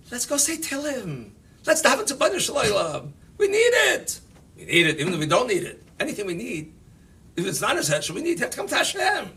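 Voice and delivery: high, fast, laughing rally chant imitation; mock rally call. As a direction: ham up the kids' excited call to pray. Acted kids' prayer rally call.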